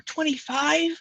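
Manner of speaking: high, stunned question then a sharp gasp; can't believe the number; amazed gasp. Real, not acted. Reacting to a viewer's old dog, amazed gasp.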